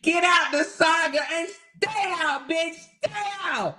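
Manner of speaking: ordering her out of the drama; angry yell. Real, not acted. Telling the rival to stay out of it, yelling.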